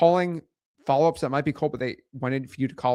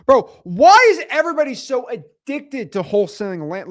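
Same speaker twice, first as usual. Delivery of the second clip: loud, higher-pitched incredulous outburst; exasperated; 7 semitones higher, 7 dB louder than usual. Real, not acted. Streamer answering a viewer fixated on land deals, exasperated. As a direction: burst out in disbelief.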